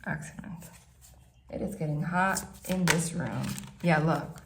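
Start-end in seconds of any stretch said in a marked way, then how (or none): none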